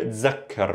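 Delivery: "tzakkar" with a buzzing z and doubled k; dialect z sound. Shown as a demonstration. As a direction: Levantine "tzakkar", z instead of th. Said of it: "with a z sound".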